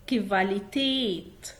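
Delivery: كفاليتيت said slowly, syllable by syllable; slow German word. Shown as a demonstration. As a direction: spell out كفاليتيت slowly as kevalitet. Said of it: "حاول تكرر معايا". (نطق كفاليتيت ببطء مقطع مقطع)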